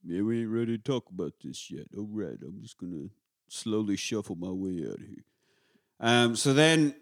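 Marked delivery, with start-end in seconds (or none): 0.0-5.2 low, soft, resigned boxer voice; Rocky impression. Rocky giving up and leaving the cell, low soft impression. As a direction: back off quietly in a low mumble. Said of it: "And so Rocky's like".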